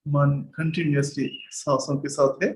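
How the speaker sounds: slow, measured meditation guide; guided meditation. Real, not acted. Teacher guiding students to sit at ease with the breath, slow meditation voice. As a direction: lead them into stillness, drawing out words between long silences.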